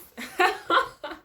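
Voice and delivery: a short, high burst of laughter; high amused laugh. Real, not acted. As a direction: laugh at the absurdity. Laughs at her own story.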